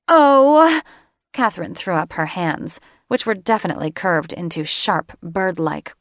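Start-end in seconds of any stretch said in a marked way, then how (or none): 0.1-0.8 much higher, much louder outcry; exasperated cry. Acted. Catherine throwing up her clawed hands, outraged cry.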